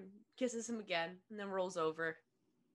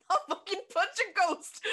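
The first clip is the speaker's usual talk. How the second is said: laughing outburst; 10 semitones higher, 6 dB louder than usual. Real, not acted. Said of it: "that's [name] losing it".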